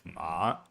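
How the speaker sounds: "ma." dipped low and soft in a third tone; "ma." low-dipping Mandarin third tone. Shown as a demonstration. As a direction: sink "ma." down into the low tone. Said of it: "It's the low part where you're like".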